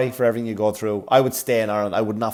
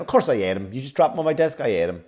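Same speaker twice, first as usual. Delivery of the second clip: ordinary talk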